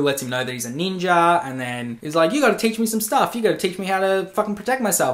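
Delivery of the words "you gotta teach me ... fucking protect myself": high, rapid-fire begging; mock pleading. Would-be protege begging a ninja for lessons, high and urgent. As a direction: pester him eagerly in a raised, hurried voice.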